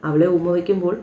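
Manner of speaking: usual speaking voice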